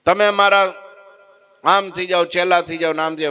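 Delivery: high, loud shouting; furious demand. Acted. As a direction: yell at him to submit. Sadhu shouts demand again. (ગુસ્સાભરી માંગણી)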